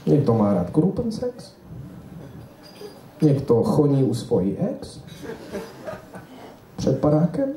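mock German accent in Czech; German lecturer character. German lecturer addresses a Czech audience, mock German accent. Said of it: "Já se vžiju do role".

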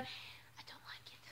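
hushed confiding aside; whispering. Real, not acted. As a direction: whisper a secret complaint. Confiding about mascara.

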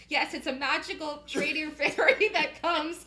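loud, high, giggling through the words; laughing hard. Real, not acted. Teacher joking about a magical trading fairy, laughing hard. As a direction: joke squealed out through real laughter.

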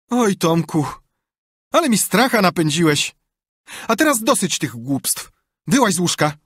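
high, loud aunt's voice; relieved scolding. A narrator's reading. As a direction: scold the boy after a fright. Aunt relieved, then scolds.

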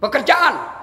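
high-pitched demand; sharp rise in pitch, questioning; stern interrogation. Acted. Rich man is interrogated.

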